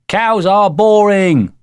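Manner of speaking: high, loud, drawn-out taunt; boy's jeering shout; cried out. A narrator's reading. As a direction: call it out slowly across a field. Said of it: "cried Jeremy James".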